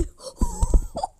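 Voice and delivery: high, breathy laugh of joy; giddy laugh. Real, not acted. Giddy after the pull. (sevinçle gülerek)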